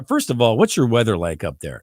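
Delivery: ordinary talk, nothing marked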